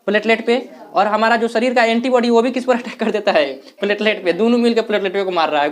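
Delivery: normal talking voice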